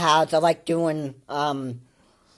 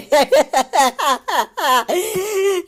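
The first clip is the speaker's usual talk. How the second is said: long high-pitched cackle; tickled laugh; 10 semitones higher than usual. Real, not acted. Reactor getting a kick out of own impression, high laugh. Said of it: "I'm really getting a kick out of that".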